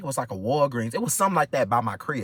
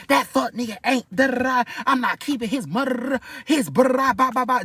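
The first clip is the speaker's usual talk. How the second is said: going off; 8 semitones higher than usual. Retold or imitated. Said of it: "Just going off".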